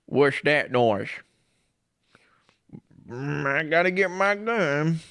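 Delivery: slow, gruff suspicious homeowner. Pretend. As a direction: drag it out like a wary old man reaching for his gun. Homeowner hearing a knock, reworded.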